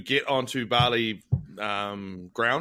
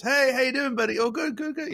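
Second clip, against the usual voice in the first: much higher, bright mock greeting; chirpy tour guide; 10 semitones higher than usual. Imitating the Bali tour guide greeting a tired tourist. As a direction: sing it out like an overfriendly guide. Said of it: "our tour guide that came out to us goes".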